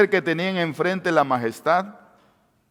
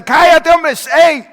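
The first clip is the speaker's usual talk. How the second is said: high, loud scornful shout; crowd shouting hush; 13 semitones higher, 9 dB louder than usual. Acted. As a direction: shut him up with a loud high yell. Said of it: "Y cuando decían". (grito alto y despectivo de la multitud)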